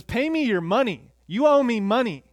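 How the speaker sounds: high, loud demand for money; angry demand. Acted. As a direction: grab him and demand the money. Servant choking his debtor.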